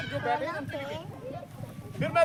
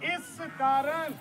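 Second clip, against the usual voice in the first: slow read-along; 2 semitones higher, much slower than usual. Shown as a demonstration. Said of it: "preacher reads slowly after reader".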